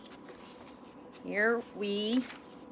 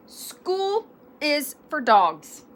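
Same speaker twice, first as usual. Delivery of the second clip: slow, emphatic, louder mama; 5 semitones higher, 5 dB louder, slower than usual. A narrator's reading. Mama telling her kitten school is for dogs, emphatic. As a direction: declare it firmly, word by word. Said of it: "stated Mama Junebug Jones emphatically".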